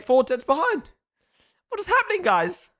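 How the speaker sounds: high-pitched bewilderment; incredulous. Real, not acted. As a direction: pitch climbing high in disbelief. Baffled by five drivers' poor results.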